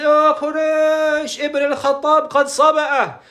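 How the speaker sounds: high, long-drawn imitation of calling out; retold scream. Jamil denouncing Ibn al-Khattab to Quraysh, imitated scream. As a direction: stretch it into a high public proclamation. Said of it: "he screamed at the loudest voice".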